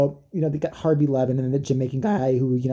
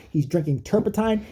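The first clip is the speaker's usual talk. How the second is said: normal talking voice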